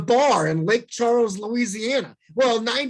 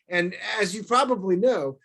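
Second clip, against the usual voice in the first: usual speaking voice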